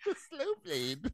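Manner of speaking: high, soft, drawn-out impression; mock movie line. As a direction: imitate her slowly, high and hushed. Repeating the imitated movie line.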